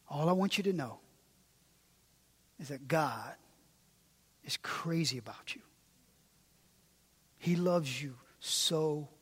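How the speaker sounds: quiet tender sermon close; hushed intimate preaching. Acted. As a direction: lean in and plead gently with the congregation. Intimate hushed altar appeal.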